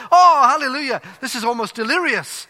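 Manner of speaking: hammed-up delirious praise; delirious congregation. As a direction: rave in holy delight. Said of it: "They're ecstatic".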